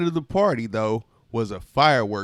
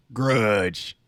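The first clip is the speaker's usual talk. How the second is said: sulky grumble; 4 semitones lower than usual. Acted. Grape names each grudge.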